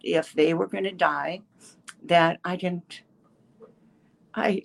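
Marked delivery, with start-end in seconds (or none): none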